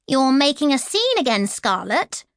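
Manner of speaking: a hint of a mocking stepmother; condescending. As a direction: belittle the girl. Stepmother mocks girl.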